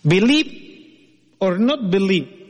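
ordinary delivery

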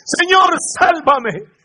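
a high, loud cry for rescue; shouted plea. Acted. Peter sinking in the storm cries out to the Lord, shouting. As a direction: yell it like a man sinking in the water. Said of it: "y gritó".

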